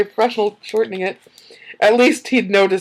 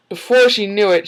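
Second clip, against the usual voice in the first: ordinary talk, nothing marked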